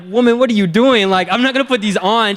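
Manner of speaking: put-on loud, high indignant kid protest; outraged protest. Kid protesting mom's floaties.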